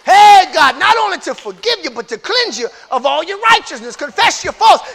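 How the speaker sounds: loud, high sermon drive; fervent pulpit voice; preaching at full force. Acted. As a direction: preach it out to the room.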